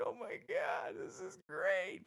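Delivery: high, thin, thrilled voice; giddy delight. Retold or imitated. Recruit thrilled at being shown the dorm, giddy and high.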